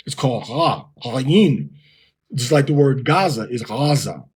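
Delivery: usual speaking voice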